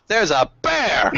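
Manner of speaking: high-pitched disbelief at the bear; baffled exclamation. Acted. Repeats it as exclamation.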